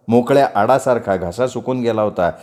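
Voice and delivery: usual speaking voice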